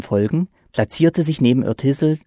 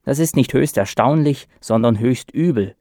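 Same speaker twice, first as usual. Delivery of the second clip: ordinary delivery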